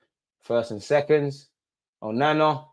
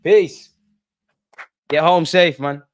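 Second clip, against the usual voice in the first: mock farewell; 5 semitones higher, 7 dB louder, slower than usual. Real, not acted. Mock farewell to leaving fans.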